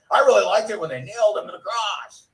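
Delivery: hammed-up excited fan; mock gushing. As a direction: gush it in a mock-thrilled lilt. Mock gushing quote he wouldn't say.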